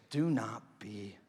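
slow, low, fading to near whisper; quoting gently, dropping away to a whisper; hushed reassurance. Retold or imitated. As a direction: let each word settle softly. Slow, hushed quote of Jesus.

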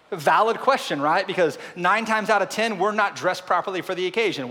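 high, loud preacher's drive; full-force preaching. Acted. Pastor voicing God's question to Adam, loud preaching. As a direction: preach it big, pushing fast.

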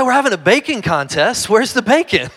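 put-on high, loud child insisting; insistent little girl. Small daughter insisting to dad about the bacon, high and loud. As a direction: repeat it like the answer is obvious. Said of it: "She said".

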